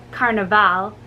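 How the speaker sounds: usual speaking voice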